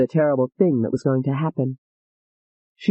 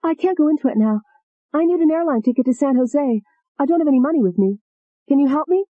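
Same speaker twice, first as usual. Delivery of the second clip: voiced young woman; 7 semitones higher than usual. Alette phones her father in panic.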